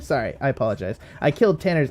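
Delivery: ordinary talk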